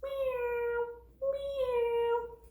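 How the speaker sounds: high, plaintive bird meow; catbird meow. A narrator's reading. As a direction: mew like a hungry cat. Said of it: "sounds like a hungry cat".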